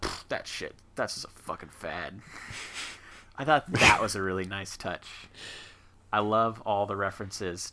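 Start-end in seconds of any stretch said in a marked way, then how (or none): 0.0-2.2 dismissive scoff. Retold or imitated. Mimics dismissive movie characters.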